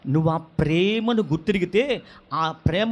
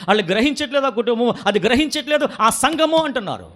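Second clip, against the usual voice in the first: ordinary talk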